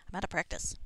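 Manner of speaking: ordinary talk, nothing marked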